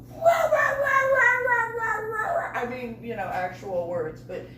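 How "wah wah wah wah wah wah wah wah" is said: loud, high-pitched "wah wah" ranting; imitated colonel ranting. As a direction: imitate an officer's tirade as wordless wah-wahs. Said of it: "I hear the full bird colonel go".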